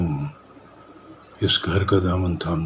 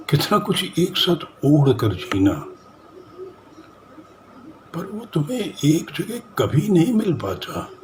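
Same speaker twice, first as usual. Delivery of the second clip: higher-pitched, insistent indictment; impassioned reproach; 7 semitones higher than usual. Acted. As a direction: press the accusation home, pitch lifted. Acted monologue confronting a woman's dissatisfaction.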